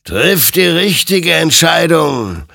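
high, loud, slow warning; a creature's slow, loud admonition; admonishing spirit. Acted. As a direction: warn him gravely from above. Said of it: "ermahnte ihn sein Gegenüber".

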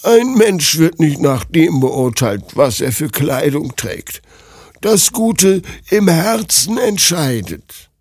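put-on slow, high old granny voice; saddened old woman. A narrator's reading. Old woman saddened by princess's words, slow elderly admonishing. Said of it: "Das alte Mütterchen war betrübt".

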